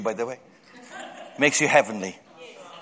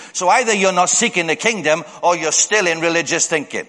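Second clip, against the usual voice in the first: ordinary talk, nothing marked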